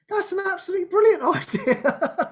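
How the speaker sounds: high, laughing mock enthusiasm; gushing enthusiasm. Agreeing to the divine intervention show, gushing and high. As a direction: gush with a laugh, pitched high. Voicing her own eager reply.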